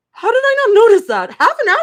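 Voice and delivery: loud, high disbelief; high incredulous outburst; shocked. Real, not acted. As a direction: blurt it out, appalled at yourself. Shocked at missing the guests.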